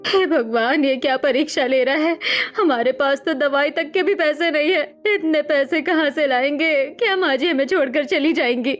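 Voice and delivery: tearful, wavering lament; crying woman. Acted. Daughter-in-law despairs over money and mother-in-law, crying. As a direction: wail helplessly through tears. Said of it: "बैठकर रोने लगती है".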